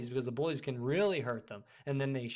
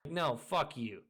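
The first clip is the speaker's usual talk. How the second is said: ordinary talk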